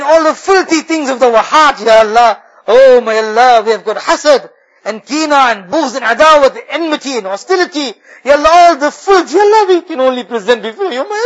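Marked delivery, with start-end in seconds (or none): none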